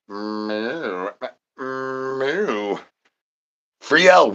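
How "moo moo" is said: soft cow moo. Acted. Cow noise after refrain.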